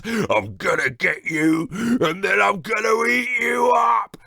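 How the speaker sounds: loud menacing giant voice; giant threatening. Acted. Giant climbing down toward Jack, roaring threat. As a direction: gloat and roar. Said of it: "said the giant".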